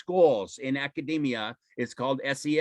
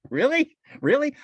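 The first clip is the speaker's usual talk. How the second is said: amazed disbelief; 12 semitones higher, 5 dB louder than usual. Retold or imitated. Mimicking people's amazed reaction.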